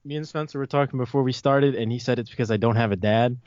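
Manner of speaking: usual speaking voice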